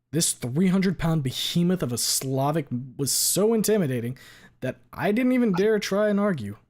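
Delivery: usual speaking voice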